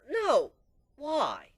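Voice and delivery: loud, sharp no and why; exasperated loud protest; loud refusal. Acted. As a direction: shut the idea down loudly. Refusing to stay up.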